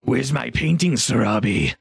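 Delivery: lightly mocking ogre. Ogre asking for a promised painting, mildly mocking. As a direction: demand it with a touch of mockery. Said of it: "The tone he gave you was very, like, mocking".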